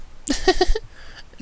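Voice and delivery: high, airy ladylike giggle; very high breathy dainty giggle; feminine giggle. A narrator's reading. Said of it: "an amazingly feminine giggle".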